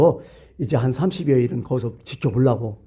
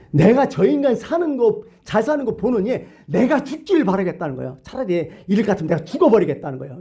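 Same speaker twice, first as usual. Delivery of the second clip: aggrieved protest; 6 semitones higher than usual. Acted. Preacher voicing Jonah preferring death to seeing them live, aggrieved. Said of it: "이런 고민이에요".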